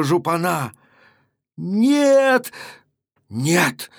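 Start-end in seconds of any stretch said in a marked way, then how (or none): 1.6-3.9 sharp cry of defiance; a wounded man's defiant yell; shout. A narrator's reading. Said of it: "закричал он". (крик раненого казака)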